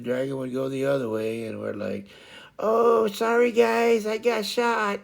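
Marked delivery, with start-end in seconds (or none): 2.6-5.0 high-pitched teammate calling back sorry; mock apology. Imitates Dragon apologising after wandering.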